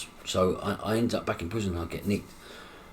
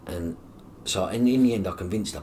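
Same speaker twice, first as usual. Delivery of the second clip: ordinary talk, nothing marked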